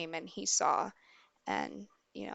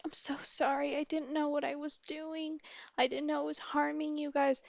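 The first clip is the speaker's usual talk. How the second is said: higher-pitched imitation of a pleading mother; retold tearful apology; 6 semitones higher than usual. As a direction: plead for forgiveness, lightly acted. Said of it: "my mom's crying, like, straight up crying".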